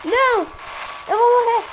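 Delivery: high, loud alarm as death looms; real fright that he is about to lose; panicked cry. Real, not acted. Gamer shrieking as his strongest robot is about to die, high and panicked.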